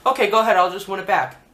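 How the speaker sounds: retold brash comeback; cocky retort. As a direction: toss it back with mock confidence. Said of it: "she's like".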